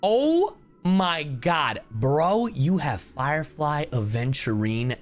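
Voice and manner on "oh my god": usual speaking voice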